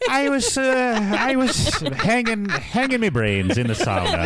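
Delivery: slow mock Irish drawl starting high; Liam Justice, Irish accent. Podcaster as the reviewer recalling the sauna, in mock Irish. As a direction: lilt down from high in a joky Irish brogue. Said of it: "questionable attempt at an Irish accent".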